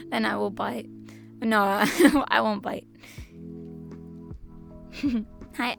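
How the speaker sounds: usual speaking voice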